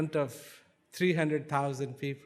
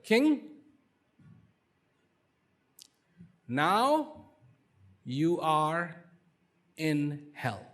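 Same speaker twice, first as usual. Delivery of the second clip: calm pronouncement; much slower than usual. Acted. Wise man's calm pronouncement.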